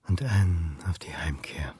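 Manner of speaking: leise geflüstert, fast tonlos; whisper. Acted. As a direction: murmelt sich selbst zu, kaum hörbar. Toasting himself alone at home. (flüsternd zu sich selbst beim Einschenken)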